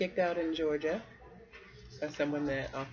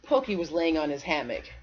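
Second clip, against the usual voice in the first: plain reading voice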